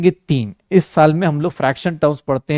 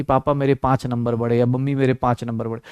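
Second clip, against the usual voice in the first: timid, softer mock confession; sheepish, low-voiced wrong way to tell parents; 6 semitones lower, 6 dB quieter than usual. Shown as a demonstration. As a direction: mumble it like a worried kid. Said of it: "कभी भी ये मत बोलना कि".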